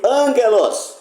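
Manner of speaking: ANgelos with the opening syllable stressed and high; angelos, accent up front; stress on first syllable. Shown as a demonstration. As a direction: hit the AN of angelos, then let it fall. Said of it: "quando se altera a sílaba tônica".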